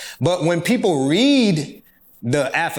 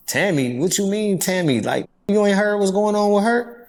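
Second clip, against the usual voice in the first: retold surprise; 8 semitones higher than usual. Retold shock at Tammy's name.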